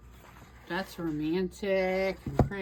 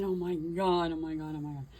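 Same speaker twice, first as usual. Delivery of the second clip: moaning in pain; 5 semitones lower, 3 dB quieter than usual. Real, not acted. After stabbing finger, pained moaning.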